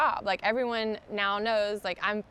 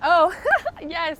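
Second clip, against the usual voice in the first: a delighted laugh into a much higher, louder yes; 9 semitones higher, 12 dB louder than usual. Real, not acted. Host asks about her project, she laughs yes. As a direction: laugh and agree, happily caught off guard. Asked about the Love Ripple.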